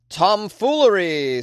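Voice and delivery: mock grand word. Listing his silly closet antics, hammed-up.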